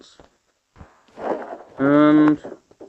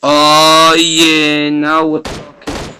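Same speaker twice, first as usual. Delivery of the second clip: long, loud drawn-out cheer; big pleased holler; gloating delight; 10 dB louder, much slower than usual. Real, not acted. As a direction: relish it loudly, stretching the words. Celebrating a good find.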